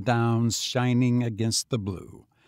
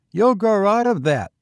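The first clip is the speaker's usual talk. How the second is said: put-on high, louder boy's retort; knowing tramp boy; 9 semitones higher, 7 dB louder than usual. A narrator's reading. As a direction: toss it back as a lad, pitched up.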